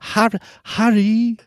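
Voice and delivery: put-on a man squeaking out a girl's voice; Jim Dale as Hermione. Host repeating the narrator's Hermione, slow high falsetto. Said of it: "That's how he says it".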